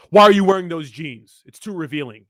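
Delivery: loud, nagging jealous guy, a bit put-on; mocking jealous boyfriend. Mocking a corny jealous boyfriend, loud nagging. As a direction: sneer at the possessive boyfriend type. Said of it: "Guys like that are so corny".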